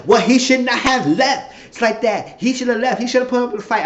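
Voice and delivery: hammed-up indignant girlfriend; loud high mock complaint. Quoting her complaint that he left, loud and high. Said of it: "She said".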